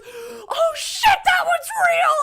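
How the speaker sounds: imitating a terrified guy, high and loud; freaked-out shout. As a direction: scream it like the dummy just came alive. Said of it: "he gets so freaked out. He goes".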